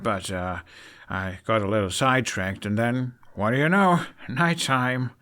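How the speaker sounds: awkward excuse. A narrator's reading. Hiccup awkwardly excusing himself to knights.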